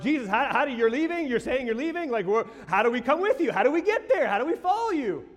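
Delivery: high, loud, rushed worried questions; anxious disciples. Acted.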